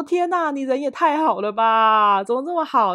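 high, loud, slow gushing thanks; overwhelmed by a coworker's kindness; gushing delight. Retold or imitated. Retelling thanks to a generous coworker, gushing and loud. Gushing over colleague's kind offer.

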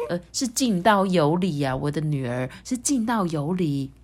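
usual speaking voice

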